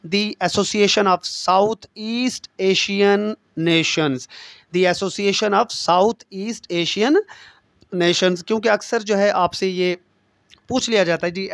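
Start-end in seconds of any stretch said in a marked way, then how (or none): 0.1-4.3 every word drawn out very slowly for note-taking; slow dictation. Shown as a demonstration. Teacher spelling out the ASEAN full name very slowly. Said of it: "ASEAN का मतलब है जी". 4.8-8.4 every word drawn out very slowly for note-taking; slow dictation. Shown as a demonstration. Teacher spelling out the ASEAN full name very slowly. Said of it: "ASEAN का मतलब है जी".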